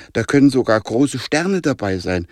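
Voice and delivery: usual speaking voice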